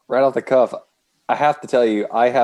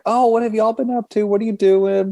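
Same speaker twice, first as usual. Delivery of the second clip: friends asking; 10 semitones higher than usual. Retold or imitated. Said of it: "some of my friends were like".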